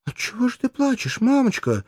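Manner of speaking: put-on high child's voice, reproachful; a small daughter scolding her mother through tears; high-pitched girl reproaching mama; reproachful little girl. A narrator's reading. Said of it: "спрашивала Постумия с упрёком".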